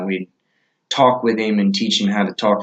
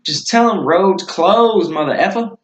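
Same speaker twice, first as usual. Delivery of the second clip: higher, drawn-out casual instruction; offhand retold order; 7 semitones higher than usual. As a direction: toss off the instruction with a slow, raised pitch. Retells own offhand instruction to Talon.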